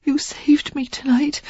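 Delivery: high airy whisper; Sarah whispering her gratitude to Derek; whispered thanks. A narrator's reading. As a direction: breathe it to him.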